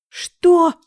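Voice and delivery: very loud, high horrified cry; shocked cry. Acted. Yana hearing terrible news, loud horrified cry. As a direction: scream it in disbelief.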